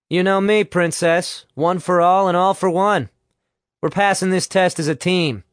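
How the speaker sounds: rally them with put-on enthusiasm; breezy team spirit. Nick lies to Alice about the team, breezily.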